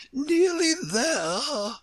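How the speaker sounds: very high, slow, stretched queenly echo; scornful Queen's echo. A narrator's reading. Queen repeating Alice's question, high drawn-out echo. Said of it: "the Queen repeated".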